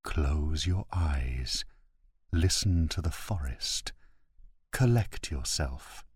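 measured, hushed, steadying instructions; calm inner voice. A narrator's reading. Inner voice guides a frightened man to listen, calm and authoritative. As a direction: give slow orders with cool composure. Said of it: "Obeying the voice".

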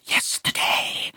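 breathy secretive whisper; conspiratorial whisper. Acted. Peter confiding to Dunbar, whispering. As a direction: lean in and whisper it. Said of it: "in a conspiratorial whisper".

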